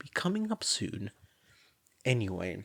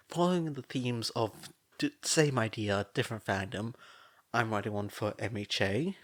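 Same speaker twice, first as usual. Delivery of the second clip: ordinary delivery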